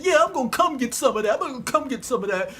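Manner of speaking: fast, high-pitched trash talk; quick, worked-up cocky reply; taunting comeback. Acted. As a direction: fire the dare straight back. David taunting back.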